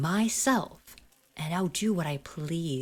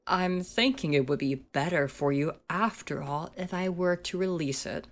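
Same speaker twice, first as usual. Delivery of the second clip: ordinary delivery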